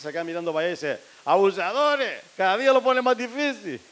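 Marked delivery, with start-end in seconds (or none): 1.2-3.8 put-on high, loud jeering drunk with a laugh; a drunk passerby laughing at the guards; mock drunk heckle.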